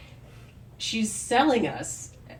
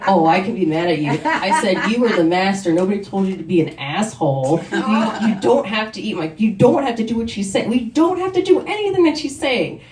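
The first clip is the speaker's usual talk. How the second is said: louder, rising, furious scolding; angry retort; 2 semitones higher, 6 dB louder than usual. Retold or imitated. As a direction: tell off the crush, building to a shout. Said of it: "And I'm like".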